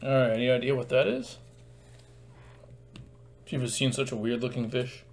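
ordinary talk

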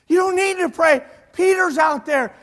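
loud, high, urgent retort; excited insistence. Retold or imitated. Rhoda to the praying group, excited insistence. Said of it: "she gets all excited".

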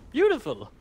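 mock delight, high pitched; sarcastic praise. Real, not acted. Sarcastic at exploding chaos.

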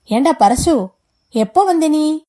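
loud, lifted voice of a recovered host; composed hearty greeting. A narrator's reading. Husband greets an unexpected visitor after a startle, hearty. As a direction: greet him loudly, covering the surprise. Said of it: "உடனே தன்னை சுதாரித்துக் கொண்டு விட்டான்".

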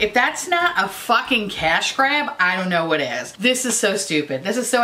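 usual speaking voice

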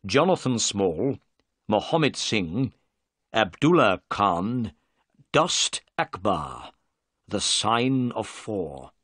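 slow, deliberate reading of a list. Acted. Holmes reading the paper aloud, slow and deliberate. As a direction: read each name out slowly.

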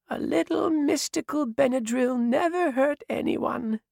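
a sly, gentle aside in a high voice; old witch. A narrator's reading. Eliana carrying the sleeping girl upstairs, old witch.

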